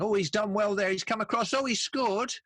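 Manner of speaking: high, excited commentator's call; football commentator. Retold or imitated. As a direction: ride the moment like a TV match commentator. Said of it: "that kind of a voice".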